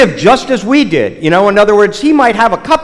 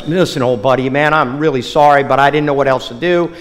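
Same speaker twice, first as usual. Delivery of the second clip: embarrassed; 6 semitones lower, 3 dB quieter than usual. Acted. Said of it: "Raleigh turns beet red and goes".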